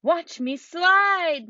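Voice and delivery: put-on loud, high and slow kid's shout; excited shout. A narrator's reading. Max shows off sliding on the ice, shouting high and slow. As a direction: call out to show off the slide. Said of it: "Max shouted".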